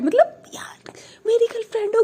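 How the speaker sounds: high whispered aside; whispered jealous remark. Retold or imitated. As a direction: hiss it under the breath.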